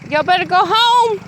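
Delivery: high, loud call shooing dogs. Real, not acted. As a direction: call the dogs home across the yard.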